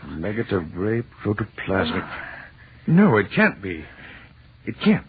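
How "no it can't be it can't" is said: stunned disbelief. Acted. Professor disbelieving the flesh.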